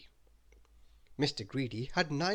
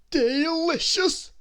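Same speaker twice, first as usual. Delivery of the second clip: high, slow, louder greedy voice; Mister Greedy, shrugging off a cake, in a high slow voice; greedy character voice; 10 dB louder, much slower than usual. Acted.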